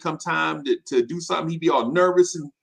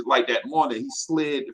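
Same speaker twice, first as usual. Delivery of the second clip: ordinary talk, nothing marked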